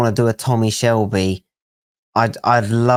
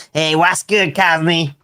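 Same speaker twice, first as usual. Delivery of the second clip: lightly imitated high character greeting; catchphrase imitation; 6 semitones higher, slower than usual. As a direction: copy someone's character voice, pitched up. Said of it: "this must be one of yours as well".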